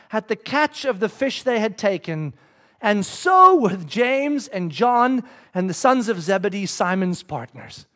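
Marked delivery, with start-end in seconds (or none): none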